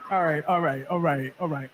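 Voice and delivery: steady yourself before spelling. Retold or imitated. Calming down to spell.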